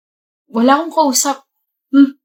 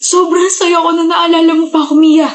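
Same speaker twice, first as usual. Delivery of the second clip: loud, tearful obsessive joy; crazed joy; 6 semitones higher, 4 dB louder than usual. Acted. Stalker tells Mia he is overjoyed, loud and tearful. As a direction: gush like a madman near tears.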